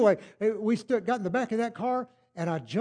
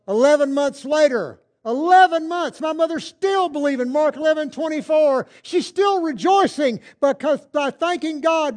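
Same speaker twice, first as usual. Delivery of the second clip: louder, higher preaching voice; full-force preaching; 8 semitones higher, 9 dB louder than usual. Real, not acted. Preacher tells his mother's faith story, loud and high. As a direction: preach it out to the back row.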